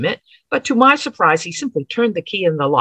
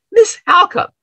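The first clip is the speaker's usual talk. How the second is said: alarmed cry; 11 semitones higher, 5 dB louder than usual. Acted. Smithy sees her leave Plotkin.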